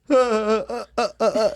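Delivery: high, chopped mock laugh; staccato, high-pitched fake laughing; imitated laugh. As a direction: imitate a bunkie's repetitive cackle in short bursts. Imitates the bunkie's laugh again.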